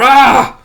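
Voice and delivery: loud, high scream of alarm; a sudden open-throated "Ah!"; startled yell. Acted. Storyteller voicing a victim attacked by the beast, startled yell.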